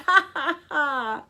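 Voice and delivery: loud, high ha-ha-ha; triumphant laugh. Acted. Mister Dart laughs at his joke, triumphant laugh. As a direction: crow with laughter.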